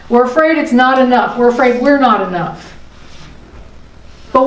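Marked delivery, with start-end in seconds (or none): none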